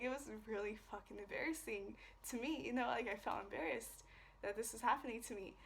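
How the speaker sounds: ordinary delivery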